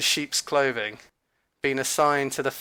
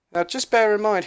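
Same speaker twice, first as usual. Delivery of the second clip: normal talking voice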